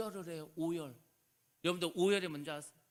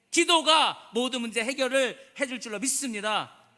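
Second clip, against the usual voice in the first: full-force preaching; 2 semitones higher, 7 dB louder than usual. Acted. Pastor urging the congregation to cry out in prayer, fervent preaching. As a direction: preach it out to the whole church, ringing.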